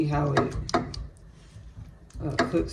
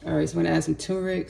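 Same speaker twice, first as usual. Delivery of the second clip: normal talking voice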